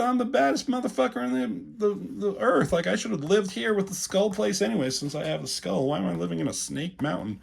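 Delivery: high, cackling villain boast; gloating Skeletor voice. Retold or imitated. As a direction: brag like a cartoon villain who just won. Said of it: "And Skeletor is just like".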